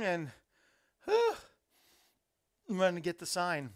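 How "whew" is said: winded. Real, not acted. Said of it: "About a breath".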